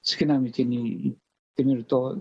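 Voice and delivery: ordinary talk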